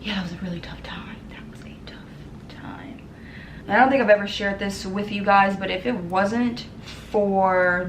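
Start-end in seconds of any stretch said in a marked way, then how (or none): 0.0-3.1 real hushed whisper, voice fading out; barely voiced, grief catching. Real, not acted. Recalling her father's death to viewers, whispered.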